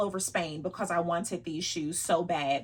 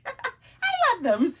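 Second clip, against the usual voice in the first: much higher, laughing through it; laughing protest; 14 semitones higher, 8 dB louder, slower than usual. Real, not acted. Vlogger laughing as she insists on loving boots, higher. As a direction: giggle while insisting. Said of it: "You hear how my voice went up?".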